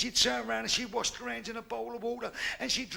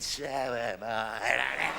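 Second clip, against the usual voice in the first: low slow reply; 11 semitones lower, much slower than usual. Acted. Man replies in bed.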